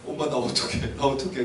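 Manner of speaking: low, soft helpless plea; mild acted fear. Younger son to his mother, softly fearful. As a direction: ask mother for help, quietly frightened. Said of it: "두려움에 떨어져".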